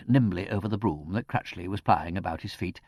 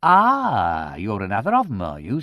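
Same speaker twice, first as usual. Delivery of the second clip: condescending sympathy; 7 dB louder than usual. A narrator's reading. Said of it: "with condescending sympathy".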